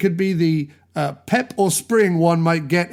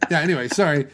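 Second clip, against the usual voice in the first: ordinary talk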